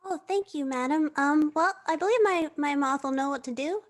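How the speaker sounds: courteous bird bowing to madam; polite Merlin. Acted. Character thanks the farmer's wife.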